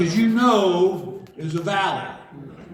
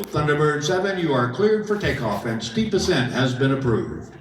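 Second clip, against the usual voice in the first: lower, measured radio voice; control tower; 4 semitones lower than usual. Retold or imitated. Tower clearing the jet for takeoff, low and official. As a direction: read it out like air traffic control. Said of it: "I hear the tower go".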